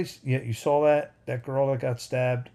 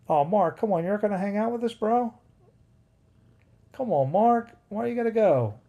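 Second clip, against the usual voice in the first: pleading; 8 semitones higher, 4 dB louder than usual. Real, not acted. Pleading with a leaving viewer.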